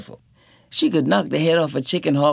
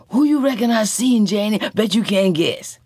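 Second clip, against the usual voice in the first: bright, high, eager teasing; bursting to share the news; beaming with excitement; 8 semitones higher, 4 dB louder than usual. A narrator's reading. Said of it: "beaming out with light".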